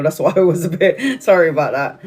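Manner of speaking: laughing mid-sentence, a bit choked up; real laugh breaking into the apology; laughing through emotion. Real, not acted. Telling friends about her vibration plate, laughing apologetically. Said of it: "I'm really emotional".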